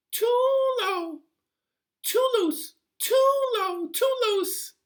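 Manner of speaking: very high, loud squeaky complaint; a fussy little sprite shrieking its gripe; high squeaky goblin whine; tiny creature voice. A narrator's reading. Hudgeon complains about the bed.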